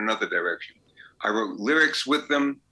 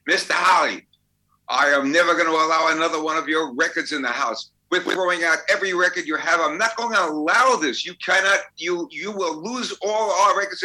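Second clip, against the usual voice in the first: higher and louder, an outraged scolding; furious mother's tirade; 6 semitones higher, 3 dB louder than usual. Retold or imitated. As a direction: retell the mother yelling at the table. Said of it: "she now starts screaming".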